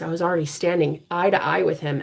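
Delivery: usual speaking voice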